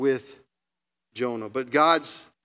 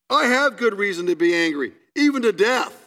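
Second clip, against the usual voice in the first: higher and louder, rising to a cry on death; defiant anger; 5 semitones higher, 5 dB louder than usual. Acted. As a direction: Jonah snaps back, stubborn and bitter. Angry Jonah answers God.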